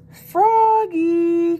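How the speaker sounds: high, loud two-note call; long sing-out holler. A narrator's reading. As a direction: stretch the name out to summon someone. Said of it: "called his teacher".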